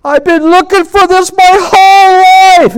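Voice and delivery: high, drawn-out full yell; imitating a man's overwhelmed outcry; yelling out loud. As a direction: holler it slowly at the top of your voice. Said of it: "he started yelling out as loud as he could".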